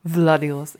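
put-on low Russian-accented Wladivostok; russian accent in german. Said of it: "mit einem russischen Akzent".

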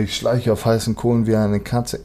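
usual speaking voice

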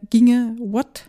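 ordinary delivery

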